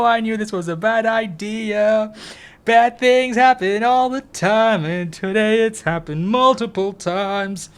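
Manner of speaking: high, wailing complaint; whiny lament. Real, not acted. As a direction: moan about the mess in a high wail. Laments the spill.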